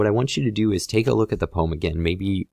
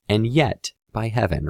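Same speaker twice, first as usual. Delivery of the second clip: "And yet, by heaven" lifted higher, a turn in the poem; raised pitch on "And yet, by heaven", signalling a change of stance; shift in tone; 4 semitones higher than usual. Shown as a demonstration. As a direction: lift your voice on "And yet, by heaven" to mark the turn. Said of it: "You shift your voice a little bit".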